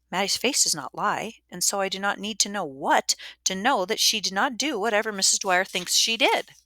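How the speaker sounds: ordinary delivery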